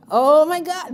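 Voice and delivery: loud, high exasperated exclamation. Acted. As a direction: exclaim as if unbearably irritated. Acted reaction to open-mouth chewing.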